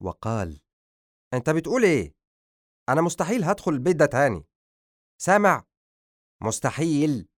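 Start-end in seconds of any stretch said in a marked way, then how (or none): 1.3-7.3 loud, higher fast outburst; angry. Acted. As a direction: cut him off furiously. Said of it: "قاطعه أدهم بعصبية".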